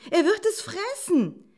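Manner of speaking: very high, loud panicked exclamation; alarmed cry. A narrator's reading. Mother doe fearing for her fawn, alarmed cry. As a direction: call out in horror for your child. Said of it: "rief das Reh erschrocken".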